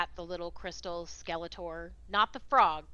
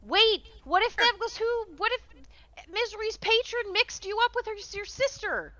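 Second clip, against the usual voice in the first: higher, louder calling out; urgent shout; 12 semitones higher, 6 dB louder than usual. Real, not acted. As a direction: shout after someone leaving. Calling after departing NPC.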